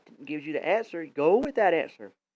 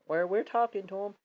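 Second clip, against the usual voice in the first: usual speaking voice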